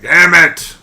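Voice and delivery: loud mock yell of a frustrated wife; imitating his wife. Husband imitates his wife yelling at the pitcher, loud mock yell. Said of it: "She's like".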